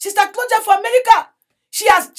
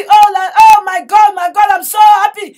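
imitating her joy; 2 semitones higher, 8 dB louder than usual. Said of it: "She's so happy".

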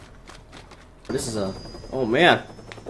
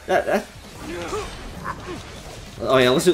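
usual speaking voice

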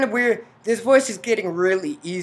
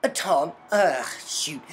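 ordinary talk, nothing marked

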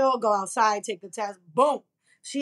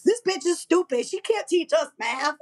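high, mocking sneer at the teacher; mean seventh grader; 5 semitones higher than usual. Seventh graders mocking their teacher, high mocking sneer. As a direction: sneer it like a bratty kid. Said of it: "would be like".

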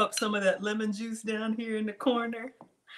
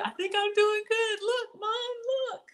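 put-on childish boast; 13 semitones higher than usual. Childlike brag to mom while cooking.

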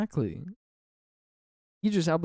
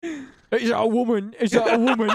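rowdy gamer voice; 6 semitones higher, 4 dB louder than usual. Pretend. Acting out angry gamers.

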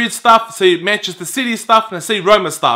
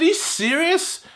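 high, drawn-out disbelieving question; retold incredulity, rising at the end; incredulous; 9 semitones higher, 5 dB quieter than usual. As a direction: ask it slowly in high-pitched disbelief. Retold disbelief at collar demand.

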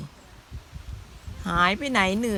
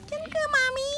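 cute high voice; 3 dB quieter than usual. Acted. Voicing the mother animal.

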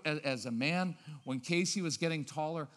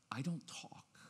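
soft unvoiced whisper; a calm parent's whisper; 3 semitones lower, 10 dB quieter, slower than usual. Shown as a demonstration. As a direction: breathe it out almost silently. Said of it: "Watch, the tone of voices".

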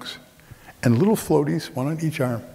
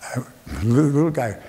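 ordinary delivery

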